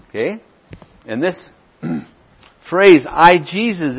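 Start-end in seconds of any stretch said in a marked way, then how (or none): 3.1-4.0 "I, Jesus" punched louder and higher; emphatic I, JESUS. Shown as a demonstration. Bible teacher showing the class an emphatic phrase, stressed emphatically. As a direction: hit "I, Jesus" hard, raised and loud. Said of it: "is emphatic".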